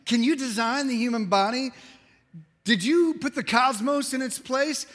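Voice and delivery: high, insistent rhetorical questioning; grilling. Acted. God grills Job over and over, insistent and challenging. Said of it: "God really grills Job over and over".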